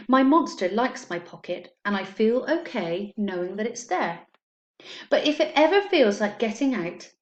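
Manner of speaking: put-on reading a picture book to children, steady and clear; story time reading. A narrator's reading. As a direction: read it like story time for a class. Teacher reads picture book aloud.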